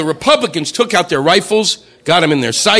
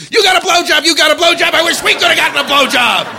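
high cheering yell, a crowd going wild; mock cheering scream; 9 semitones higher than usual. UN delegates cheering the president, mock yelled. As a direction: holler it like a crowd in a stadium. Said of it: "They were screaming".

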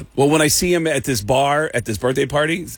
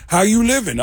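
high put-on comedian voice; high-pitched, emphatic mimicry; Tracy impression; 8 semitones higher, 3 dB louder than usual. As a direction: carry on explaining in the high imitation. Retold Tracy continues explanation.

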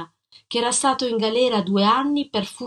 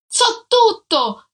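slow, much higher, louder shout; 12 semitones higher, 5 dB louder, much slower than usual. Acted. Jealous husband to his wife at the door, shouting. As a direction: yell it to silence her.

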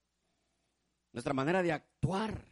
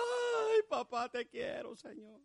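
high, drawn-out tearful cry to papá; 10 semitones higher, much slower than usual. Acted. Weeping man telling God he loves him, tearful cry. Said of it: "Y ahí estamos llorando".

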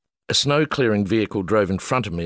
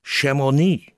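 put-on higher, French-accented, insistent; French official repeating; 6 semitones higher, slower than usual. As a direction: repeat the town name firmly in French. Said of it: "he said again".

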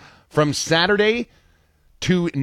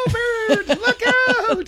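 much higher, panicked cry; high squeal again. Retold or imitated. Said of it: "repeating his own squeal".

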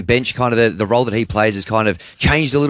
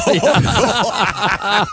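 big laugh; 10 semitones higher than usual. Real, not acted. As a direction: crack up at the joke. Laughing at the Horn ball joke.

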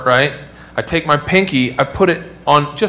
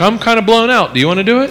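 tired wife; 7 semitones higher, 3 dB louder, faster than usual. Retold or imitated. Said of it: "my wife's like".

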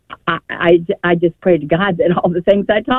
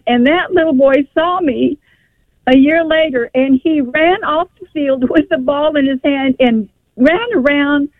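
emotional recollection; 6 semitones higher than usual. Real, not acted. Tearful memory of grandson.

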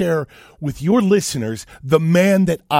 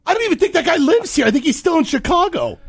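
high, loud, quickening outburst; incredulous rant; 4 dB louder, faster than usual. Real, not acted. Host doubting the caller lives here, incredulous shouting. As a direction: rail in loud disbelief. Outraged after caller hangs up.

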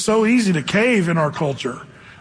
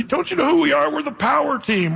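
much higher, boastful big-guy voice; bragging strongman; 9 semitones higher than usual. Retold or imitated. Strongman telling Peter who they are, high and boastful. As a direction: play the muscle man proudly announcing who they are. Strongman answering the street preacher.

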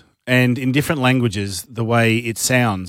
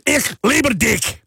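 slow, high-pitched mock-German bellow; harsh German; 12 semitones higher, slower than usual. Shown as a demonstration. Host spoiling the romantic list with harsh German. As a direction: punch each word like an angry command.

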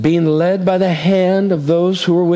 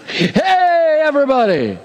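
much higher pretend shout to the crowd; mock megaphone shout; 9 semitones higher than usual. Preacher joking that God should announce it, mock shout. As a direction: call it out to everyone like through a megaphone. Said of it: "Why not just megaphone it".

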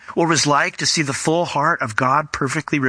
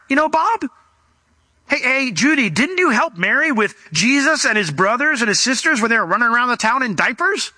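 much higher, gossipy townsman calling out to friends; know-it-all neighbour; 8 semitones higher than usual. Acted. Pastor voices a villager reminding the crowd, high and gossipy. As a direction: play the smug sensible villager. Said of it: "decided they needed to bring some rational thought".

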